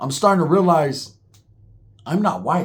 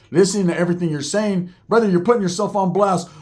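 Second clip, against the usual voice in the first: ordinary delivery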